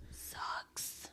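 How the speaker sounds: unvoiced whispered aside; fiancée answering a mocked guest, whispered. Real, not acted. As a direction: breathe it out as a mock-pitying whisper. Mock sympathy aside.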